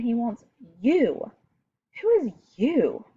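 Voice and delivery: "you" lifted out of the sentence; stress on you. Shown as a demonstration. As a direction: lean hard on the word "you". Reading capitalized words with emphasis.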